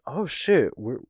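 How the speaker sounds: high-pitched disbelief, rising at the end; imitating dawning amazement; mock surprised Killer. Killer realising they might survive, mock surprise. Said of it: "And now Killer's like".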